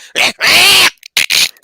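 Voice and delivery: high, loud strangled cat cry; cat cry imitation. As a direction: mimic a dying cat. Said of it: "and you hear the cat".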